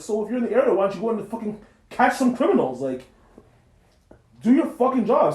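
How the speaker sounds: loud, high, heated retort; angry telling-off. Retold or imitated. Driver telling off officer at his house, angry. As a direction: build to an exasperated, forceful order.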